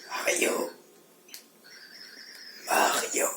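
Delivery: soft unvoiced call of a name; whispering. A voice calls to Mario.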